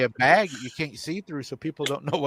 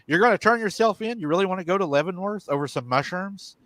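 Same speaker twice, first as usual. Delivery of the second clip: retold yelling; 6 semitones higher than usual. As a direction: imitate a wife yelling, without real volume. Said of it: "She's yelling at me".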